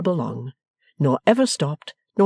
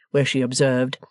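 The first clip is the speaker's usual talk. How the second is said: plain reading voice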